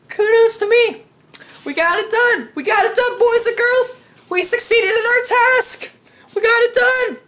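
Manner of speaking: high, victorious boast; triumphant. Real, not acted. Telling boys and girls he finally got it done, triumphant. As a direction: crow about the win to the audience.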